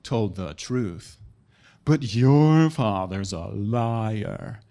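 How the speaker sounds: very slow, drawn-out sinister warlock; hammed-up, ponderous dark sorcerer. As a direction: intone it like a robed warlock, dropping low. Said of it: "this dude was like".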